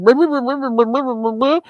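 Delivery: garbled gibberish imitation of a slurring rival; mocking slurred mumble. Streamer shows rival how she slurs, mocking garble. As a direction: mimic her mumbling as a run of nonsense syllables. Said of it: "That's you going".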